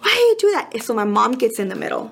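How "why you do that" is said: put-on high, fast whiny child's yell; imitating son's scream. Mom imitates son screaming in the car, high and whiny. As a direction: mimic a teenage son yelling at his mom. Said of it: "I'm like".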